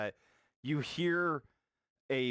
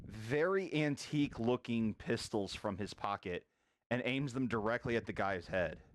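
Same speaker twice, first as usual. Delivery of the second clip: ordinary delivery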